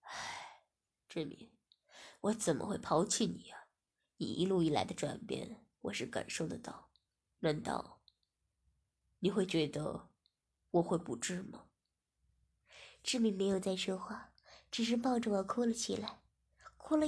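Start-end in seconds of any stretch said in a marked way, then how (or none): 0.0-11.7 a weary sigh, then gentle low reassurance; sighing, resigned. A narrator's reading. Master sighing as he reassures the girl, low and gentle. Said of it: "我听后叹了口气便说".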